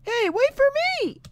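very high cartoon cry; squeaky call. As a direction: call after them in a tiny squeak. Game character calls after others.

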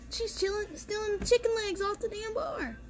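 high incredulous complaint about mom; exasperated squeal. Retold or imitated. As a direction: squeal it to your wife in disbelief.

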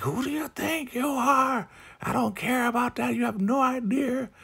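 very high mock falsetto; shrill shriek voice. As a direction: squeak it out in falsetto. Marva shrieking back.

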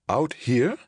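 high, rising disbelief; startled question. Acted. Passenger questions the unexpected stop, startled.

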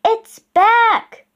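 high, loud excited call; thrilled high holler. A narrator's reading. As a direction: shout the big news through the woods. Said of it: "Annie called from the woods".

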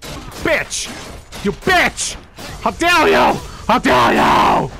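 furious yelling, voice cracking high; raging at the screen; shaking with anger. Real, not acted. Player raging at game death.

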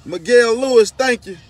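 loud thanks; calling out. Real, not acted. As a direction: call out the thanks.

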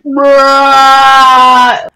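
one word held out loudly, level pitch; long loud bruh. Retold or imitated. Kid replying to the pizza guy, long loud bruh. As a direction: drag it out as a loud mock comeback. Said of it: "guy is saying".

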